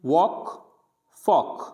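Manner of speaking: वॉक and फॉक with no l before the k; vowel straight into k in वॉक, फॉक; वॉक, फॉक with the L left mute; silent L. Shown as a demonstration. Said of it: "यानी L नहीं पढ़ेंगे".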